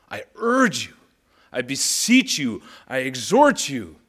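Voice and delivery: high, loud, slow and pressing; urgent emphasis. Shown as a demonstration. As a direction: press each verb like a plea. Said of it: "unpacks the word urge with emphasis".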